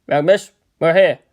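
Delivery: plain reading voice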